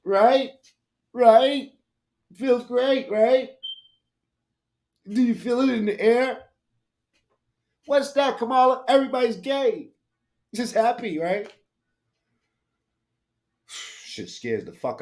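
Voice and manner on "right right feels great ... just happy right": high, bubbly, over-happy; mocking a grinning politician; mock giddy Kamala.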